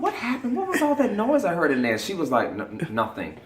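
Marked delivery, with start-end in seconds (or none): 0.0-2.0 high curious voice. Retold or imitated. Stephanie voiced. 2.5-3.4 embarrassed stammer. Retold or imitated.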